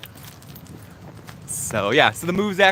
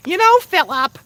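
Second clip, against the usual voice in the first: mom voice; 6 dB louder than usual. Retold or imitated. Mimics mom.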